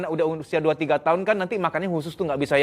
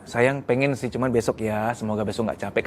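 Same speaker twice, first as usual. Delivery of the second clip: gentle refusal; 5 semitones lower than usual. Shown as a demonstration. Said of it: "kan enak dengernya".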